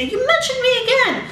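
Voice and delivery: high mock outraged friend voice; friend's voice. Imitating the friend scolding her for mentioning him again. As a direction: squeak it out like an indignant pal. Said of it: "he's gonna message me ... and say".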